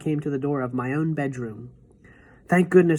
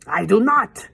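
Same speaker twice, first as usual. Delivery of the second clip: a higher, louder witch shout; 6 semitones higher, 6 dB louder, slower than usual. A narrator's reading. Witch shouts at the grandmother, angry. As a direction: fling the answer back, furious. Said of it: "shouted the Grand High Witch".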